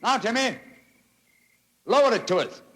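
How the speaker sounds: put-on high call up to the boy. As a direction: shout the instruction upward. Calling up to Timmy.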